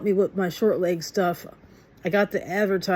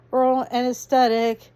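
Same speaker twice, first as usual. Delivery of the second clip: normal talking voice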